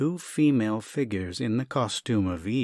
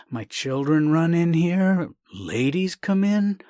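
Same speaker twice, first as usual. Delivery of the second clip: higher, louder, slow flustered plea; flustered protest; 7 semitones higher, 6 dB louder, slower than usual. Acted. Doctor objecting to the gift, flustered higher protest. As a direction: plead the scandal of it.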